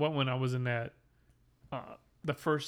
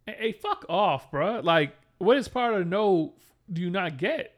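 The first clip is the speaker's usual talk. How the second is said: slow, loud, higher telling-off; retold angry yell; 5 semitones higher, 7 dB louder, slower than usual. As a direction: snap at the pushy vendor to back off. Retold telling off pushy vendor.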